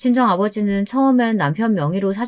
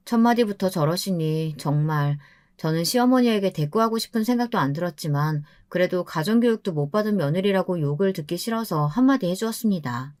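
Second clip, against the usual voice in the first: ordinary delivery, nothing marked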